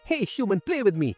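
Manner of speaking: high-pitched taunting dagger voice; teasing, goading creature; provoking. A narrator's reading. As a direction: needle them in a high voice. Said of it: "The dagger kept provoking".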